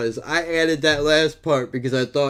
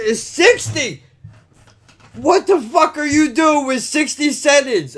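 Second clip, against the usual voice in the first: disbelieving yell; 10 semitones higher, 3 dB louder than usual. Retold or imitated. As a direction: shout the number back in disbelief. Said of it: "I said".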